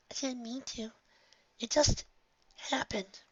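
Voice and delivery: high, soft and broken; a little filly crying out an apology; tearful filly. A narrator's reading. Filly whispers an apology to Nightmare Moon, soft and tearful.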